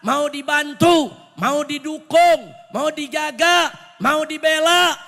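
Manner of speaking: rally the crowd with mounting force; fiery preaching. Real, not acted. (khotbah berapi-api, makin keras dan menekan)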